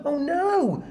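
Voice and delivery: usual speaking voice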